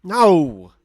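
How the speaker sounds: loud, drawn-out no; mock exasperated shout. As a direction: shout down a daft guess. Mock exasperation at wrong guess.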